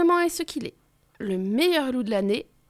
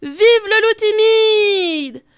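slow, loud, high cheer; cheering shout; 8 semitones higher, 8 dB louder, much slower than usual. Acted. Crowd cheering the shy wolf, loud. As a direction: chant it in triumph.

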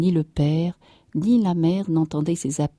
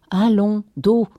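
raised, louder order to sleep; brisk command; 5 semitones higher, 5 dB louder, slower than usual. A narrator's reading. Farmer's wife putting the child back down, brisk command. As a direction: order it curtly, higher and louder.

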